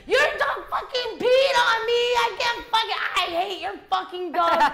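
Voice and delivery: high, loud, laughing angry rant; furious friend. Retold or imitated. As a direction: yell at the dog's owner, half laughing. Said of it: "She's like".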